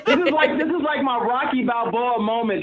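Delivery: excited, high-pitched gushing; elated. Real, not acted. Winner calls it his Rocky Balboa moment, elated. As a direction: gush it like a big victory.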